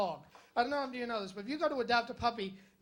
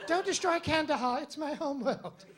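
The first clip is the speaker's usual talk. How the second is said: hammed-up sci-fi plea; 4 semitones higher than usual. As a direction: beg like a movie alien. Star Wars character pleading parody.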